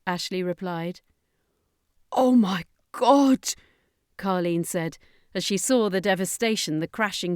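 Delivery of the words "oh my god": loud, slow, high horrified cry; shocked cry. A narrator's reading. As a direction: gasp at the ruined city. Carleen sees the devastation.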